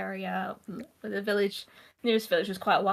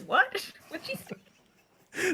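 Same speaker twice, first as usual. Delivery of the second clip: confused surprise; 8 semitones higher, much slower than usual. Retold or imitated. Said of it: "And I was like".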